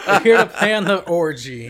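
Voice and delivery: can't hold back the laugh. Real, not acted. Friend retelling the film's orgy line, laughing. Quoting Zeus while laughing.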